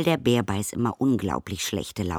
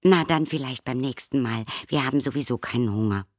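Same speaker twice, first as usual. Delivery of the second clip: higher, quick polite reply; polite visitors; 7 semitones higher, faster than usual. A narrator's reading. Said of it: "sagten höflich".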